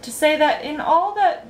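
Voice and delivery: high, tentative speech opening; timid. A narrator's reading. Young guest starting a banquet speech, timidly. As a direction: begin a formal address with mild timidity. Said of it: "started Milo timidly".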